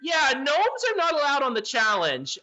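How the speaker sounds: high, chirpy gargoyle, mock regret. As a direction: voice the cheery official gargoyle. GM voices the gargoyle again.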